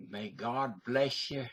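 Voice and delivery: put-on a mild Southern US accent for a closing blessing; a light American South drawl. Speaker closes the show with a blessing, in a mild Southern accent. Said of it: "prayer said in the rich accent of the American South".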